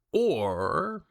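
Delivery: ordinary talk, nothing marked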